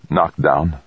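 ordinary delivery, nothing marked

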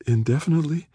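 high, frightened question; quavering. A narrator's reading. Rachel hearing she'll be frozen, quavering. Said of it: "her voice quavering".

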